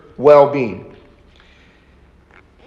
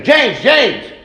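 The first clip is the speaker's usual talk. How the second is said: mother calling the name twice, somewhat higher; urgent repeated call; 5 semitones higher than usual. Retold or imitated. Parent sensing danger calls her child, mildly urgent. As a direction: call the child again with a touch of alarm. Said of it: "because there's great danger".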